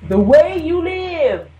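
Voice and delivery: slow, loud, drawn-out answer; emphatic declaration. Real, not acted. Answering Kathy about salvation, slow loud emphasis. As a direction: lay it down slowly like a final word. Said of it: "emphatic answer to Kathy".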